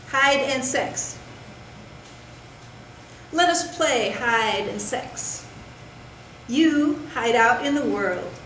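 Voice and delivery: deliberate recital, pausing after each short line; spaced-out poetry reading, each phrase set apart; poem reading. Acted. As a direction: recite it like solemn rules of a game. Reads own title poem.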